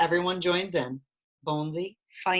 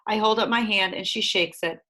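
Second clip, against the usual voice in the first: usual speaking voice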